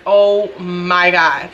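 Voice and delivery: slow, drawn-out embarrassed oh my God; mortified groan. Retold or imitated. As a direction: let the embarrassment drag every word out. Mortified as mom laughs loudly.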